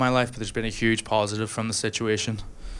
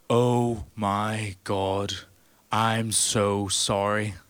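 slow apologetic giant; much slower than usual. Retold or imitated. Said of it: "and he goes".

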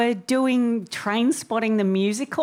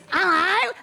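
put-on high English kid's hello; a pitched-up proper English hello; middle-class English child; 10 semitones higher than usual. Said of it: "This voice".

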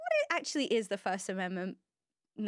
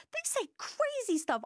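squeaky mock voice; 12 semitones higher, slower than usual.

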